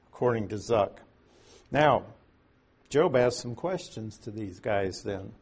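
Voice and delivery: ordinary talk, nothing marked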